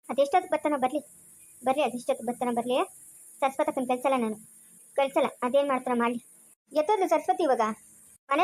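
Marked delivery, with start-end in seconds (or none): 0.1-6.3 low-pitched, contained anger; low, grim defiance. Acted. Brother refuses to send his sister back, grimly defiant. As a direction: dig in stubbornly, voice dropped. Angry man vows to await the husband.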